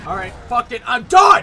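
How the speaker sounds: loud outburst building to a scream; rage quit shout. Real, not acted. Player giving up on the game, rage quit shout. Gives up after repeated failure.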